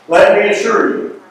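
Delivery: ordinary talk, nothing marked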